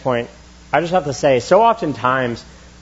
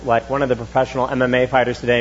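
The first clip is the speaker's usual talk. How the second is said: normal talking voice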